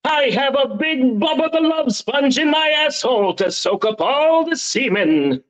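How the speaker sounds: lightly voiced heroic Superman; a hint of a superhero announcer. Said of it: "In the Superman voice".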